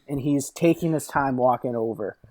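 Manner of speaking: ordinary delivery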